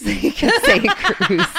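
laughing through the words, high. Real, not acted. Sister cracking up retelling brother's catchphrase, laughing. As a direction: crack up while saying it.

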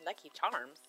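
soft, offhand Irish attempt; understated Irish brogue; Irish accent. Shown as a demonstration. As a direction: toss it off quietly with an Irish lilt. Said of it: "I just did it".

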